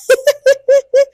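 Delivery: short high laugh; a quick real laugh; burst of laughter. Real, not acted. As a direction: laugh out at your own joke. Laughs at own joke.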